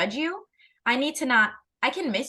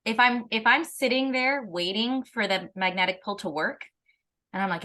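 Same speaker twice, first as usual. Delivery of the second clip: ordinary talk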